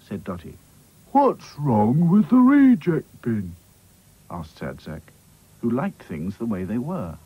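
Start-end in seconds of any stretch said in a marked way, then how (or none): 1.1-3.6 slow, glum doll voice; Sad Sack's voice. Acted. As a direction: drag it out glumly. Sad Sack speaks.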